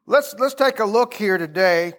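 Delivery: ordinary delivery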